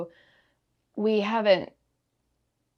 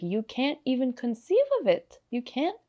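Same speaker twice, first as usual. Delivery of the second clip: higher-pitched imitation of a French accent; godmother's French accent; 7 semitones higher than usual. Godmother's words from the other side, in French accent. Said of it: "she kept saying in her accent".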